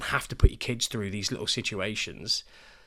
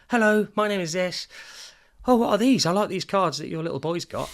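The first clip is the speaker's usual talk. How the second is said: put-on high, loud, eager child's voice; chatty little kid; 11 semitones higher, 7 dB louder than usual. Parent imitating a chatty nursery kid, high and eager. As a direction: bounce it up high like a confident kid. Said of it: "They come over".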